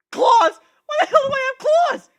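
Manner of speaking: very high, very loud freaked-out shriek; panicked shout. Acted. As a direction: scream it in disbelief. Realizes he has claws.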